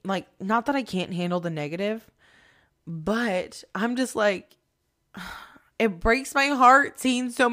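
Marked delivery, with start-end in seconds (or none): none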